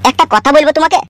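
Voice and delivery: normal talking voice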